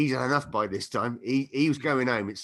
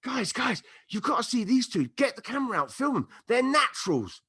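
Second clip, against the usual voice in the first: much higher, excited call to friends; 11 semitones higher than usual. Retold or imitated. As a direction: hype them up to the crowd. Stallholder calling friends over.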